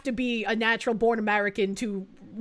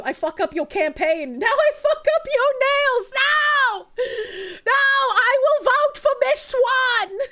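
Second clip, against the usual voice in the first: high, slow hammed-up accent turning into a mock wail; playing a pleading campaign rival in a put-on accent; mock Chinese accent; 11 semitones higher, slower than usual.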